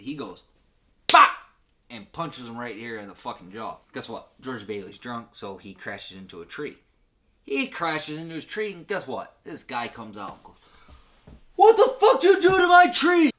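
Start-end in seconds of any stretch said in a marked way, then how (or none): 1.1-1.3 sudden loud, high "bop"; explosive mock impact noise; punch sound. Imitates the punch landing. 11.6-13.3 hammed-up high, loud scream; acted full-volume outrage; outraged yelling. Tree owner confronts crashed George.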